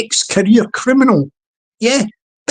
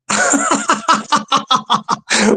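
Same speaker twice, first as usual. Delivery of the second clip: long staccato chuckle; laugh; 2 semitones higher than usual. Real, not acted. Amused by his own settees joke, long laugh. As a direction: break into a long run of ha-ha laughter.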